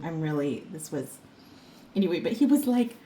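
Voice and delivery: ordinary talk, nothing marked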